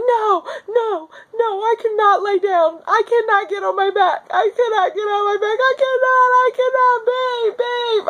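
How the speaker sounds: high, crying shout of refusal; crying protest. Retold or imitated. In labor, crying to husband that she can't lie down. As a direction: refuse through tears, calling for him.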